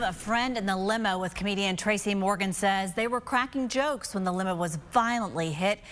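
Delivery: usual speaking voice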